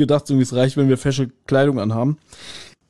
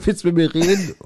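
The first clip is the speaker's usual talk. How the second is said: ordinary delivery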